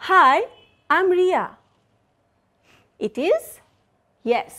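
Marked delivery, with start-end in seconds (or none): 0.1-1.4 "Hi, I'm Ria" with the pitch falling at the end; falling intonation. Shown as a demonstration. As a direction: let "Ria" drop away. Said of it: "falling intonation".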